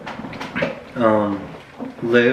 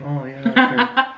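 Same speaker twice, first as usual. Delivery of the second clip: sarcastic; 11 semitones higher, slower than usual. Retold or imitated. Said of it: "everyone's like".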